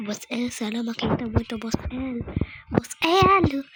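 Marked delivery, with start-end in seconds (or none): 2.7-3.6 loud eager shout; calling out. Real, not acted. Fan shouting out to his favorite streamer, loud call. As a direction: yell the name like a fan.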